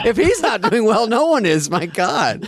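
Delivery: high, rising mock outrage; mock exasperated rant. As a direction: rant in disbelief, voice climbing high. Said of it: "I go".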